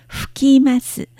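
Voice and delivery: usual speaking voice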